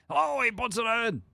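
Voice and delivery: high, chesty, congested sportscaster call; guttural play-by-play exclamation; Dave Johnson. Acted. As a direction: belt it as if punched in the sternum. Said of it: "that's how you get into the".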